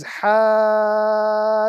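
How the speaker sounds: "hajjaka" with the ha vowel held long, then a doubled jim; Quranic recitation. Shown as a demonstration. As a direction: recite "hajjaka", stretching the ha, joining hard on the jim. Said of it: "the correct pronunciation of this particular example is".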